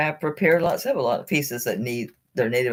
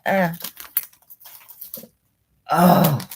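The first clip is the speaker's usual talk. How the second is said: ordinary talk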